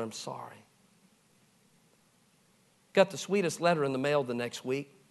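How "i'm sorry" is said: a very soft, low I'm sorry; hushed apology. Retold or imitated. Pastor apologizing again to the couple, very softly. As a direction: say it barely above a breath. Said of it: "I said".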